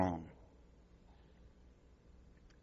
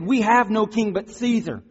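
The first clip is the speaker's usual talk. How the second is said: high, forceful declaration; defiant raised-voice answer; loud retort; 11 semitones higher, 8 dB louder than usual. Acted. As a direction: answer back firmly at full voice. Priests shout answer back to Pilate.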